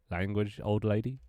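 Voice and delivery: milder telling-off at ordinary volume; toned-down mock scolding. Streamer corrects it for a not-young viewer, mild mock scolding. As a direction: redo the scold gently, as an offering.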